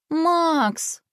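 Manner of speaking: high, drawn-out exasperated moan; sister's plaintive whine; groaning. A narrator's reading. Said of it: "простонала сестра".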